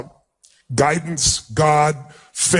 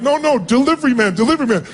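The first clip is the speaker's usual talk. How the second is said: higher-pitched retold protest; flustered protest; 8 semitones higher than usual. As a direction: act out flustered insisting to an overjoyed woman. Protesting to woman kissing him.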